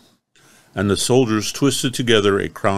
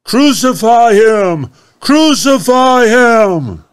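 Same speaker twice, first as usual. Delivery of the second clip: much higher, loud slow screaming demand; crowd shouting; 14 semitones higher, 9 dB louder, slower than usual. Acted. Chief priests crying out against Jesus, furious shout. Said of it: "they cried out".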